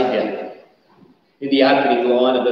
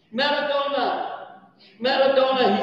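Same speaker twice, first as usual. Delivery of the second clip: awestruck commentator; 11 semitones higher, 4 dB quieter than usual. Retold or imitated. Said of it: "This is what he said".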